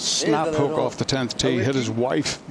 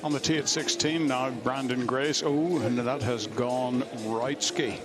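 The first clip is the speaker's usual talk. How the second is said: golf commentator; 5 dB quieter than usual. Acted. Hushed on-tee golf call.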